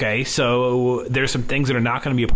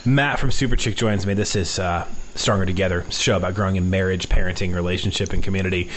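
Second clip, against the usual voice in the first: lower-pitched radio host ident; show intro; 5 semitones lower than usual. Real, not acted. Host re-introducing guest and show to listeners, low announcer delivery.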